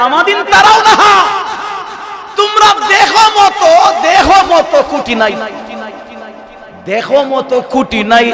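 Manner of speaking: high exclaiming sermon, fading at the end; preaching at full force. Acted. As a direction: fling it out, then come down.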